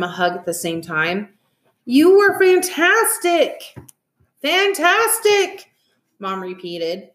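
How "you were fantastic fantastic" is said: high, drawn-out exclamation; slow, high-pitched raving; gushing praise. A narrator's reading. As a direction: stretch it out, overcome with delight. Parents gush over the performance.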